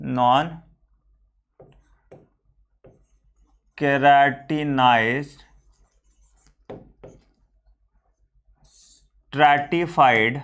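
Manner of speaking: drawn-out, syllable-by-syllable term; slow dictation. Real, not acted. Teacher writing out the tissue layer for students, slow dictation. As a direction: dictate slowly as you write, with long gaps.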